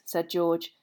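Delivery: usual speaking voice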